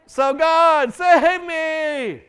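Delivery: high, slow, strained cry to God; desperate plea. Pretend. A hurting believer begs God, high, slow and strained. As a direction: beg God as if at the end of your rope. End of acted bargaining prayer.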